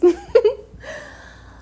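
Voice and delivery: light high-pitched laugh. Real, not acted. Laugh at own joke.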